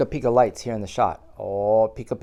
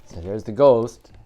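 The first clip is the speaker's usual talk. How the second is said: usual speaking voice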